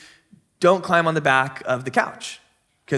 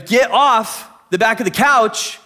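put-on slow, higher-pitched scolding of a child; firmer parental order; 4 semitones higher, 3 dB louder than usual. Dad repeating himself to his daughter, slow raised-voice order. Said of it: "I go".

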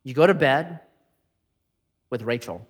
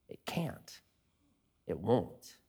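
near whisper, soft and low; quiet, settled verdict; hushed; 8 semitones lower, 18 dB quieter, slower than usual. Acted. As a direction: let it fall to a hush. Hushed sermon climax.

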